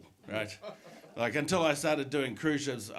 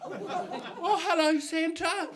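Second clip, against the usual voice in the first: put-on little girl; 13 semitones higher, much slower than usual. Child voice on phone.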